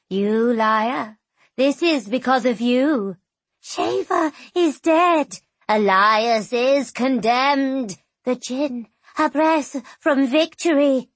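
accusing, rising outrage; angry ghosts. Acted. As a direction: hurl blame at her.

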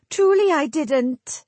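high, weepy pleading; tearful wail. A narrator's reading. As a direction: sob through the apology. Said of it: "moist sobs".

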